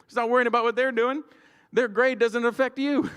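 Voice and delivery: high, loud schoolyard scolding; scolding a classmate, high and loud; mock scolding. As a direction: tell off the nosy kid.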